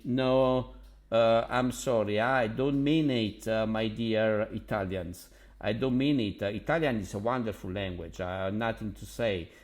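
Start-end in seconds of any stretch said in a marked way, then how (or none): none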